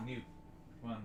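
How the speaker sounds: ordinary talk, nothing marked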